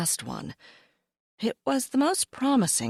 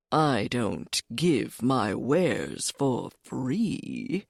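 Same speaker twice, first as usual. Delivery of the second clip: slow, low, secretive cat; sly cat voice; 6 semitones lower, much slower than usual. Acted.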